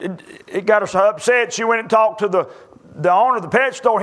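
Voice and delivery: normal talking voice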